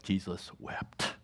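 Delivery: slow, breathy whispered reading; hushed whisper. Acted. Preacher hushes the congregation with a slow whisper. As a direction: let the two words fall in a whisper. Preacher reads the shortest verse.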